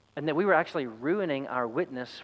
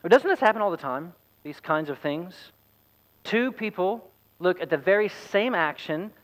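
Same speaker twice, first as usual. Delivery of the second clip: usual speaking voice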